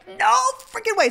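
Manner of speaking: high, loud "no freaking way"; disbelief. Retold or imitated. Mom reacting to a Yahtzee in disbelief. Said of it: "I'm just like".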